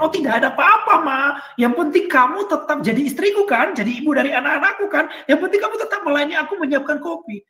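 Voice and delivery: sarcastic sketch of a too-easygoing husband; mock naive husband. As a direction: parody the husband who shrugs it off. Mocking a naive husband's reply.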